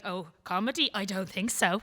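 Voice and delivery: loud, sarcastic scoffing dismissal; mock scoff. Doubters mocking her comedy ambitions, loud sarcastic scoff. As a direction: scoff at the very idea, loudly. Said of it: "And then they're like".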